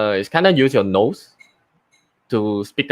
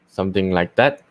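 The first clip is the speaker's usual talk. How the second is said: ordinary talk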